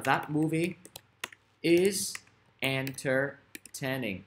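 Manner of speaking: word by word, slowing right down; drawn-out example sentence with pauses; slow dictation. Shown as a demonstration. Teacher giving students an example sentence, slow and deliberate.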